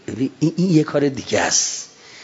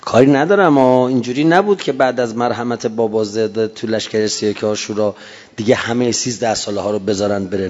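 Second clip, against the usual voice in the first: ordinary talk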